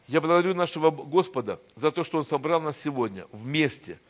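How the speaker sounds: normal talking voice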